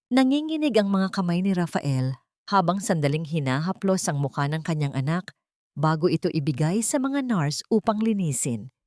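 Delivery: usual speaking voice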